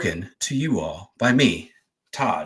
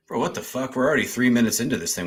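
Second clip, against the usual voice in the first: usual speaking voice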